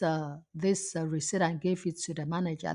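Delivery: ordinary talk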